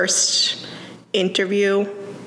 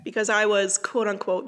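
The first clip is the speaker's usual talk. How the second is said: usual speaking voice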